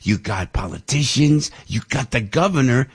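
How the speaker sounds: ordinary delivery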